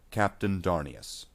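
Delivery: ordinary delivery, nothing marked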